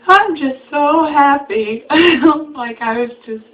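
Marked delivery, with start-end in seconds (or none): 0.1-1.8 teary half-laughing, higher, louder and slow; mock crying happy. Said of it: "hysterically crying". 1.9-2.5 bright burst of laughter, higher and louder. Real, not acted. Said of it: "laugh after quote".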